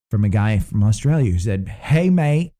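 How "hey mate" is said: put-on a half-hearted Australian accent on Hey mate; a quick mock Australian greeting. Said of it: "I'm not even gonna try and do the accent".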